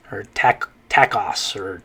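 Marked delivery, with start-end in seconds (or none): 0.9-1.6 tacos as TACK-oss; anglicized mispronunciation. Shown as a demonstration. Teacher tries another English guess, tacos said TACK-oss. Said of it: "we'd probably say".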